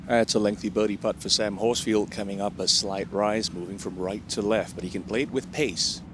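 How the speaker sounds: ordinary talk, nothing marked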